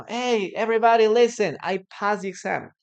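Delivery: loud, fast, excited announcement; student shouting about the passed exam; happy shout. Retold or imitated. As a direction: call it out to everyone. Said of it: "shouting that".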